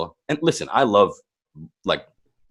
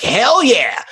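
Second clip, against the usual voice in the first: retold hell yeah, pitched much higher; excited yes; 11 semitones higher, 4 dB louder than usual. Girlfriends eagerly accepting a party invite, high excited cheer.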